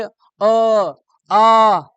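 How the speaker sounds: slow अ then loud आ; unrounded vowel demo. Shown as a demonstration. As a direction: lips spread on अ आ. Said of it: "इससे नहीं बनेगा". (होंठ गोल किए बिना अ आ)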